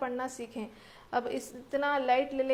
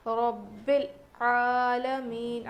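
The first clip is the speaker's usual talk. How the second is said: slow melodic tajweed recitation of رب العالمین; slow melodic Quranic chant; 4 dB louder, much slower than usual. Shown as a demonstration. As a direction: chant رب العالمین slowly, drawing out the vowel. Model recitation.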